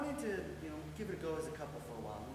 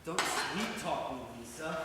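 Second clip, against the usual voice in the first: slow, louder sarcastic rebuke; sarcastic warning to a partner; 2 semitones lower, 7 dB louder, slower than usual. Acted. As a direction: push back with drawn-out sarcasm. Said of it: "Don't get sarcastic".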